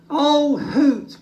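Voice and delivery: high, loud glad cry; relieved cry. Acted. Little Bear greets Hoot the owl, high and relieved. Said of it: "cried Little Bear".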